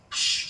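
sharp "psh" shotgun blast; sudden burst of mouthed gunfire; imitated gunshot. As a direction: mouth a shotgun sound effect.

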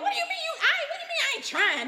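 high, fast, laughing protest; mock heated argument. Arguing with husband over faith, high laughing protest. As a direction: replay the fight, half laughing, way up high. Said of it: "we would argue".

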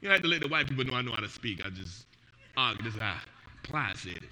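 low mock aside. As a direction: mumble it under your breath. Comic aside, dropped voice.

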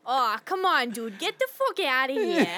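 loud, tough Boston guy; loud mock New Yorker; loud friend impression. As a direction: bark it like a street kid. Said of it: "doing an impression of me".

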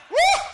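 high, loud "woo"; exuberant whoop. Real, not acted. Preacher whooping to the congregation mid-sermon, exuberant. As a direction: let out a sudden high whoop.

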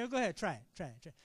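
casual, fading off; offhand permission. Acted. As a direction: toss it off without urgency. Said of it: "It's not just saying".

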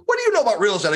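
high-pitched imitation of a cranky friend; mock a crusty doubter's challenge; curmudgeon. Grumpy investor challenges the caller, curmudgeonly. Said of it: "little bit curmudgeon-y at times".

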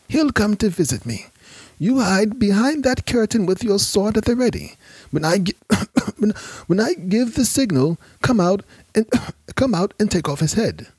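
high, strained plotting broken by coughs; coughing sick man. Acted. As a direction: push through the cough to give orders. Sick Shadik plots, coughing.

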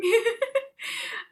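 a bright laugh that climbs up; rising laugh. Real, not acted. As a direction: burst out laughing at the memory. Laughing at crying memory.

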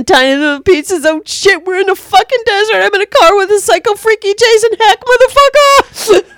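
loud imitation building to a high frantic yell; mock panic. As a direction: start wary, climb into hammed-up hysterics. Acting out panicked carjacker from film.

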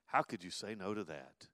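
ordinary talk, nothing marked

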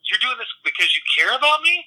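put-on high-pitched, disbelieving drunk customer; imitated wonder, voice lifted well up; touched amazement. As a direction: act out being amazed someone cares. Drunk customer touched by concern, retold.

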